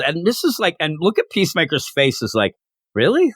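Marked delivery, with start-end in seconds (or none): 2.9-3.3 high, louder disbelieving question; can't believe the praise; mock astonishment; amazed. Peacemaker amazed at being praised.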